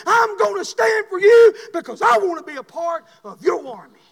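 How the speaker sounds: high, shouted rallying sermon; full-force preaching. Real, not acted. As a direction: build to a roaring call to enlist for God. Altar-call preaching at full force.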